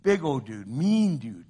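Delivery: normal talking voice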